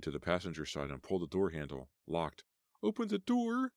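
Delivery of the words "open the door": much higher, desperate wailing plea; high wailing cry to open up. Acted. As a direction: wail at the locked door. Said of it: "she wailed".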